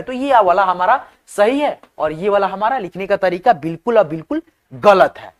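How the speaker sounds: ordinary delivery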